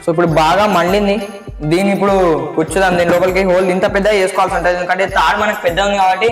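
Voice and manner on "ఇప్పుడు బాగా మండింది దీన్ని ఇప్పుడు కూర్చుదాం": ordinary talk, nothing marked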